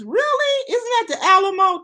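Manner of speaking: high, loud really that leaps up; surprised. Real, not acted. Host asking a Houston viewer about the Alamo, surprised and high. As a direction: sound amazed and a bit teasing.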